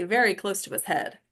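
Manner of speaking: ordinary talk, nothing marked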